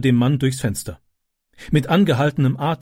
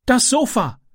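put-on high urgent cry with a foreign accent; panicked foreign boy yelling about the sofa; Ram shouting; 11 semitones higher than usual. A narrator's reading. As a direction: call out the alarm in broken German. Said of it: "rief er".

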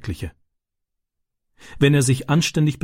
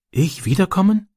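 put-on higher, tentative child's question; shy question; 8 semitones higher than usual. A narrator's reading. Ram asks Francis whether he may come back, shyly. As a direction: ask timidly, lifting the pitch. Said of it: "fragte er schüchtern".

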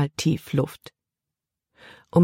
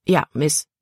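curt, grudging politeness; not so polite. A narrator's reading. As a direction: say it properly but let resentment through. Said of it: "seine Stimme klang nicht so höflich wie seine Worte".

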